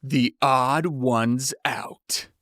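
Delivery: slow, weighty title reveal; slow announcing The Odd Ones Out; grand reveal. Shown as a demonstration. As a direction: spell out the name slowly like a big reveal. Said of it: "That's the name of the comic".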